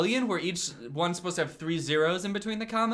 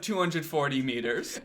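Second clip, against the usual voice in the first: usual speaking voice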